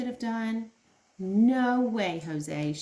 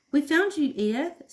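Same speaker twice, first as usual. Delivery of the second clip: shouted; 8 semitones higher, 6 dB louder than usual. Acted. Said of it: "shouted Jilly".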